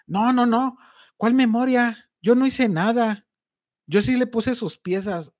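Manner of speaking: lightly voiced thief insisting he did nothing; denying thief. Thief denies over phone.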